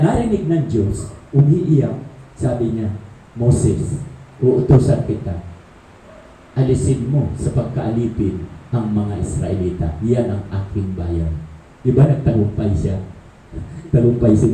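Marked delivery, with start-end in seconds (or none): 3.3-11.3 much lower, solemn divine voice; low commanding God voice. Acted. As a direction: speak down as God to Moses. God speaks to Moses in story.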